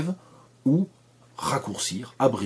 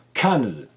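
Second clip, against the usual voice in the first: Canada with a big CAN, endings almost swallowed; exaggerated stress. Shown as a demonstration. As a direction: exaggerate CAN in Canada and let the rest vanish. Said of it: "Si vous exagérez".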